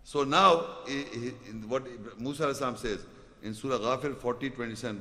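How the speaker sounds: ordinary delivery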